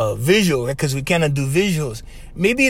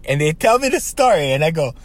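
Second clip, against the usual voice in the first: usual speaking voice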